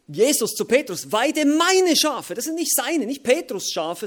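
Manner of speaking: high, loud proclamation; preaching climax. Acted. Preacher telling the church it is not his flock, loud and high. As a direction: ring it out over the hall.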